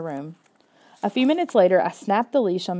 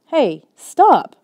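higher, louder shout at the dog; cry out; 7 semitones higher, 8 dB louder than usual. Acted. Boy crying out as his dog bolts after a squirrel, shouting. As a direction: yell for the dog to stop. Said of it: "I let out a cry".

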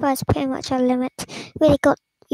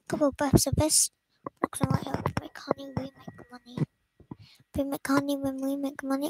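whisper; 8 dB quieter than usual. Acted. Smoker calms the bees.

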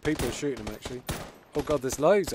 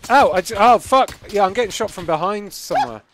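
pained shouting; 6 semitones higher, 12 dB louder than usual. Real, not acted. Shot from behind.

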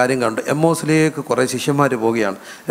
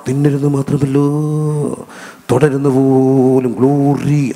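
slow, drawn-out over-holy preacher parody; mocking imitation of quavering, super-spiritual speech; mock vibrato preaching; slower than usual. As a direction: stretch the words out like a showy revivalist. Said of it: "ഇങ്ങനെ വൈബ്രേഷനൊക്കെ ഇട്ടിങ്ങനെ പറഞ്ഞ്".

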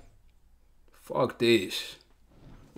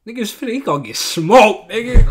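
excited outburst; 5 semitones higher, 6 dB louder than usual. Real, not acted. Reacting to a character falling asleep.

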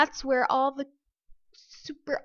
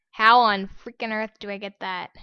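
normal talking voice

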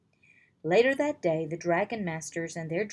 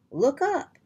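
high, loud warning cry; urgent call; 7 semitones higher, 7 dB louder than usual. Acted. Worm warns Drake of the dragon above, high loud urgent call. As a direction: call out sharply to look.

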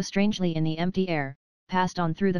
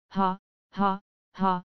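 spoken, measured "ha, ha, ha"; roared mock laugh; slower than usual. A narrator's reading. Narrator voicing Goodman Brown when the wind laughed, understated mock laugh. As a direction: read out a laugh without really laughing. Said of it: "roared Goodman Brown".

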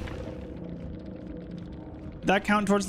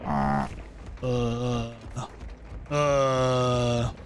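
deep zombie groan; Minecraft zombie; 7 semitones lower, 4 dB quieter, much slower than usual. Acted. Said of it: "Minecraft zombie voice actor".

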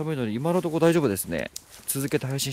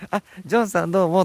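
high, loud, flirty greeting; type voice; 7 semitones higher, 10 dB louder than usual. Shown as a demonstration. Streamer shows how she greets her type, high and flirty. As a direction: greet him like you're smitten. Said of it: "私のタイプなら声変わる".

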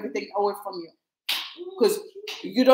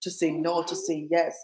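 low, earnest prayer voice; lower, earnest blessing spoken over the listeners; 5 semitones lower than usual. Acted. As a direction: pray over them, steady and lower.

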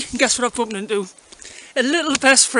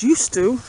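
"used" leaned on with a falling tune; wry weight on "used"; stress on used; 5 dB louder than usual. Real, not acted. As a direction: lean hard on "used" so it means not any more. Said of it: "and I emphasise the word used to".

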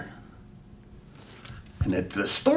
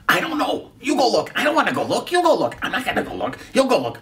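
squabbling disciples; 7 semitones higher, faster than usual. Acted. Disciples squabbling over the door.

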